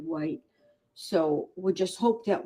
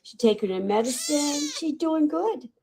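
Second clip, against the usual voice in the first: voicing the kitten; 7 semitones higher than usual. Rescuer speaks for the kitten to viewers, high cutesy voice. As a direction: speak for the kitten in a tiny happy voice. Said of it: "Tell everybody".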